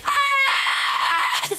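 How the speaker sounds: sudden high scream; high shriek. Real, not acted. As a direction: let out a sharp shriek.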